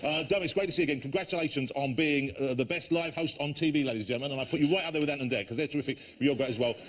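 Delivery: bright, mock-gushing TV host patter; chat show host. Host gushing to the audience, bright chat show patter. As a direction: play the over-eager host talking up his guest.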